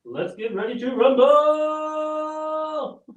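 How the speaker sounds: booming ring announcer, drawn out; fighting game announcer. Pretend. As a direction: stretch it like a boxing ring announcer. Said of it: "I get to use my announcer voice".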